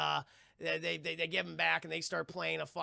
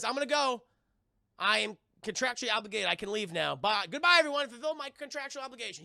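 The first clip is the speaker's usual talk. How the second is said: Bill Murray impression; 6 semitones higher, 5 dB louder than usual. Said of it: "Bill Murray also says".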